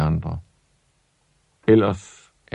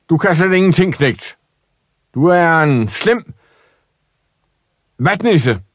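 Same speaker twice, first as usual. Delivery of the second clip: scornful shout; 8 semitones higher than usual. Acted. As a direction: yell the insult at him. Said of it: "råbte hans far".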